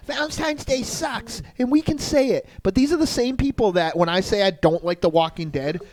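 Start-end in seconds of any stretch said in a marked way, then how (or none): 0.1-2.5 mocking whiny voice. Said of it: "Twenty people would swoop in and say".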